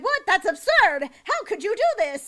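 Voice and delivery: high, loud outraged aliens; hammed-up shocked alien; scandalised disbelief. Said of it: "they're just like".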